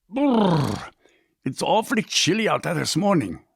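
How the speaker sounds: father back from the freezing outdoors, shivering; shivering from cold. Acted. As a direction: shake off the cold as you enter.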